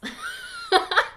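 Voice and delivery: a sudden very high burst of laughter; high giggle. Real, not acted. Laughing at a viewer's awkward story, high giggle.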